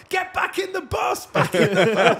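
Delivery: high, loud shout to a whole group; frantic shouted order. Retold or imitated. Host herding the guns back aboard, frantic shout. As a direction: bellow it in a mock panic. Acting out rushing guns onto bus.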